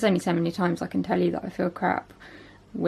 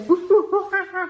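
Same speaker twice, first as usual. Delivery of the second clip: monkey noises; 10 semitones higher than usual. Pretend. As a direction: hoot like a playful monkey. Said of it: "You go".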